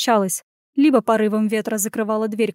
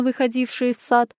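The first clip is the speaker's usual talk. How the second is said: ordinary delivery, nothing marked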